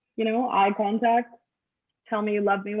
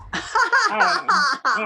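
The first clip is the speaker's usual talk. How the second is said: laughing; 8 semitones higher, 6 dB louder, much slower than usual. Real, not acted. Laughing, drawn-out aside.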